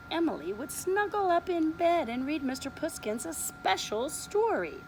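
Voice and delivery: usual speaking voice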